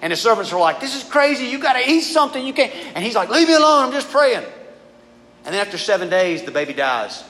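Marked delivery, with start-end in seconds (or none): 0.8-2.7 pitched-up, insistent mock alarm; exasperated urging. Servants urging David to eat, exasperated. 3.3-4.4 loud, high mock protest; irritated snap. Praying David to his nagging servants, irritated snap.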